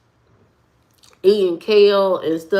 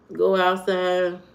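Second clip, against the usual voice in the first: ordinary delivery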